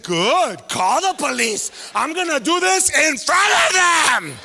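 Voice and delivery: loud slow defiant mom; mom yelling. Retold or imitated. Mom to son in front of neighbors, loud defiance. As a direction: shout it out for the whole neighborhood.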